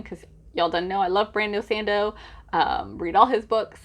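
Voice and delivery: ordinary talk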